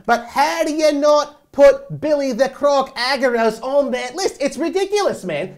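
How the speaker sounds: loud, high Australian impression; big mock-Australian voice; Australian accent. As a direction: ham up an Aussie accent, slow then exclaiming. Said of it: "That's a pretty good accent".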